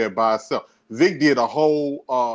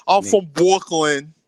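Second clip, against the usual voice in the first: slow, louder mock Brooklyn accent, R dropped; strong Brooklyn accent; 5 semitones higher, 5 dB louder than usual. Teasing a homegirl over her R's, mock Brooklyn accent. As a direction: exaggerate a Brooklyn accent, higher, drawn out. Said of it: "she got that strong Brooklyn accent".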